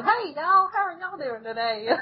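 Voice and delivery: hammed-up Southern country accent, starting high; a folksy Southern hello, played for laughs; mock Southern drawl. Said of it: "I felt just like this little country hick cousin".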